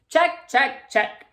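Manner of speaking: high, loud rhythmic checks; brisk repeated check. Acted. Missus V ticking items, high and rhythmic. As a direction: tick them off briskly. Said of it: "Missus V repeats".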